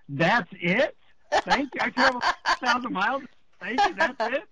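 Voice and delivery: high, disbelieving retold protest; incredulous. Retelling his disbelief at the mantra.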